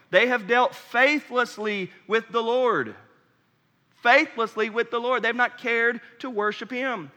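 fervent sermon delivery; fiery preaching. Acted. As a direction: preach it out to the whole church. Preaching at full force.

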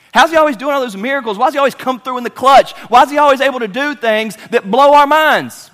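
high, loud, rapid-fire questions; full-force preaching. Real, not acted. Preacher marvelling at the miracles, full force.

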